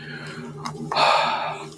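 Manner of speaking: long visible breath out; cold air breath. Real, not acted. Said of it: "you can see my breath now".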